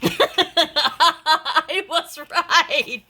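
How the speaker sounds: high, loud laugh through the words; laughing triumph. Real, not acted. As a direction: crack up at being proved right. Guessed right, bursts out laughing.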